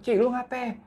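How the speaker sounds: high-pitched, alarmed question; retold panic. Three friends rushing to Uci's room, retold panic. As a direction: act out a panicked check on a friend. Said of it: "Kita bertiga panik".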